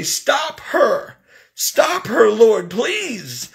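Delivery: high, pleading cry to the Lord, half reenacted; desperate cry to Jesus. Caregiver begging Jesus to stop the old woman, high desperate plea. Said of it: "I screamed really loud".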